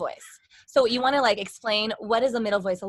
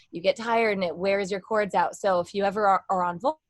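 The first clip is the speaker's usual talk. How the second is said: ordinary talk, nothing marked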